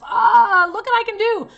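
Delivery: high, loud, hammed-up proud crying; mock show-off. Shown as a demonstration. Mocking actors who flaunt crying for casting, high and loud. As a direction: show off your tears like a party trick. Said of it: "you can break down into this terrible emotion".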